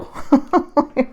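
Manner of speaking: loud, high laugh after an outburst; a real release of laughter. Real, not acted. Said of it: "laughing after outburst".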